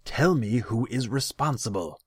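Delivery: high, loud, heated order; angry demand. A narrator's reading. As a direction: demand it, flushed with anger. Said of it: "Tasigur's face flushed".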